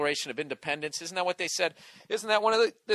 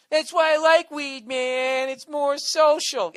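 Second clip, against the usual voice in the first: higher, louder, slow lazy stoner mimic; a hammed-up stoner, higher and slow; mock stoner voice; 11 semitones higher, 9 dB louder, slower than usual. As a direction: sneer at a hippie stoner by voicing him. Mocking pothead defending weed.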